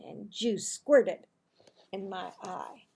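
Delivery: ordinary delivery, nothing marked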